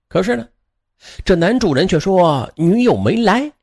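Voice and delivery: usual speaking voice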